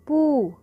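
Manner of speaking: pu called out clearly for the class; teacher's model sound. Shown as a demonstration. Teacher to young pupils, calling out पू loud. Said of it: "तो क्या बन गया?".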